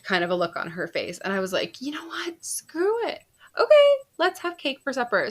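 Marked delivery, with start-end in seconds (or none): 1.8-5.3 high whispered "screw it" swelling to a louder happy yes; mock giving in. Mom caving to daughter about cake for supper, whisper then loud glee.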